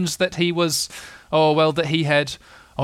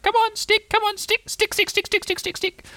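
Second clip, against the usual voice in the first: very high, squeaky chant; Roo calling out. A narrator's reading. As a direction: cheer it on in a tiny squeal. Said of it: "calling out".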